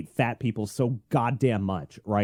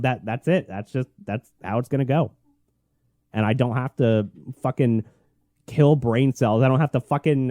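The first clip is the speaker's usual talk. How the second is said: usual speaking voice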